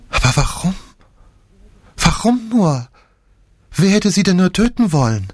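high, pleading grief; despairing. A narrator's reading. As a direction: ask why through shock and grief. Nick grieving over dead cousin.

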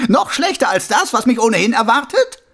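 high, loud mocking voice; a smug killer playing innocent; sneering villain. A narrator's reading. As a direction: Carcer mocking the watchman. Karzer laughs.

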